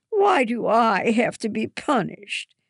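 put-on a girl whining about unfair punishment. A narrator's reading. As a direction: plead like a sulky child. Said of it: "Hannah whines".

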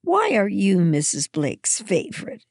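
put-on abrupt, high-pitched child's question; sudden question. A narrator's reading. Hannah suddenly challenges the narrator about being favorite, blurting. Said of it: "Hannah asked suddenly".

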